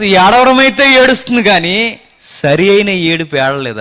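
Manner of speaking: ordinary talk, nothing marked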